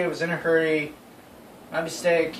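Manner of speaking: ordinary delivery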